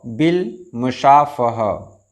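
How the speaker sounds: बिल मुशाफ़ह drawn out slowly, final ह sounded; बिल मुशाफ़ह, बहुत धीरे, आख़िरी ह साफ़; slow careful pronunciation. Shown as a demonstration. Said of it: "आप यहाँ देख रहे हैं फ ह".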